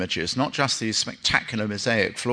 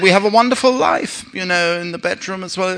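mock wife; 8 semitones higher than usual. Wife complains to husband in joke.

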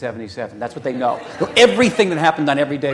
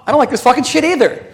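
loud, high swearing reply; match his fury right back at him; yelling back at a friend on a late call; shouting back; 11 semitones higher, 9 dB louder than usual. Retold or imitated. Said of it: "And I said".

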